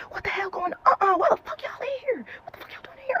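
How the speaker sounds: high-pitched imitation of an angry mother; mock outraged mom. Mom walks in on the fight, high mock outrage. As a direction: squeal it like a scandalized mom.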